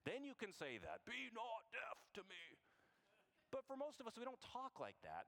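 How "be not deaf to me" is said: high, slow, hammed-up British accent; terrible British accent. Preacher jokes that you gotta be British, terrible British accent. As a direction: put on a bad, drawn-out British accent. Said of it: "That was a terrible British accent".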